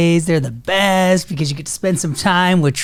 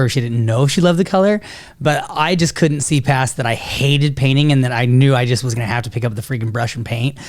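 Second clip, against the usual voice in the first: ordinary delivery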